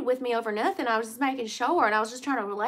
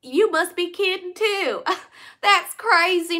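disbelief; 6 semitones higher, 7 dB louder, much slower than usual. Real, not acted. Realising the name mix-up.

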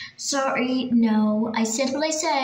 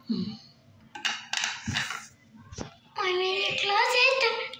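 spooky ghost voice; 8 semitones higher, 3 dB quieter than usual. Acted. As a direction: play a hiding ghost scaring the kid. Spooky voice teasing from hiding.

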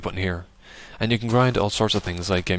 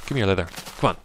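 ordinary delivery

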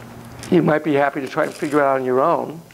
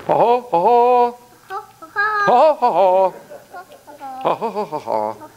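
knight voice; 5 semitones higher than usual. Acted. Knight play.

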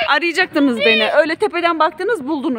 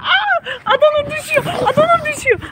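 excited cry; 12 semitones higher than usual. Real, not acted. Mother sees daughter slide down.

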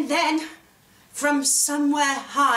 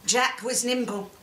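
usual speaking voice

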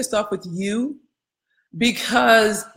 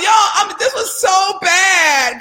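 higher, louder, slow exclaiming; outraged shout; 9 semitones higher, 4 dB louder, slower than usual. Real, not acted. Coach confessing her old outrage to the class, loud and slow. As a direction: drag out how bad it was. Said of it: "I was outraged".

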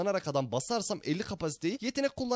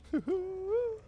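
very high giggle; high-pitched laugh. Real, not acted. Streamer reacting to his game, high-pitched giggle.

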